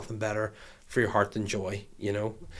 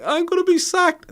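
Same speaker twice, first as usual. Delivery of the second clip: high wailing mock sob; hammed-up tearful wail; imitating crying driver; 7 dB louder than usual. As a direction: squeak it out like a panicking man in tears. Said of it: "he was crying, he was like".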